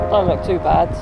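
normal talking voice

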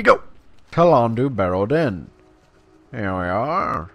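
ordinary talk

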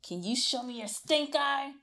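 usual speaking voice

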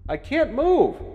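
high, loud echoing call; father shouting from afar; distant shout. A narrator's reading. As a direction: call from the other end of a bunker. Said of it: "sounded like he was at the other end".

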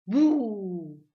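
booming scary boo; loud boo. Acted. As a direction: spook them again from hiding. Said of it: "volvió a oír".

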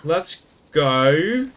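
accidental British lilt, rising at the end; British-sounding. Real, not acted. Player moving on through the level, sounding a bit British. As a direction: slip lightly into a British accent. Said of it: "why I'm sounding so British".

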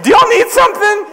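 high, squeaky, unthreatening question; timid retold call. Failed attempt to scare intruders.

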